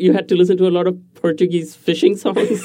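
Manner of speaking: teasing question through a laugh; amused, chuckling through the words. Real, not acted. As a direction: laugh while you ask it. Teasing guest about fishing songs.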